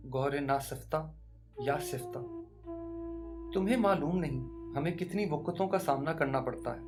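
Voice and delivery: usual speaking voice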